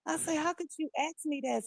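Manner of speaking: high, loud disbelieving reproach; indignant. Retold or imitated. Answering her cousin, high and indignant. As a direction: throw it back at him. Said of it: "I said".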